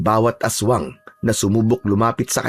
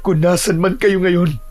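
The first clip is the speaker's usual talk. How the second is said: higher, tearful voice with broken pauses; tearful grief; 7 semitones higher than usual. Acted. Amaro weeping for his dead wife and child, tearful grief. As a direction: call out to lost loved ones through tears. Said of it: "hindi niya napigilan ang mapaiyak".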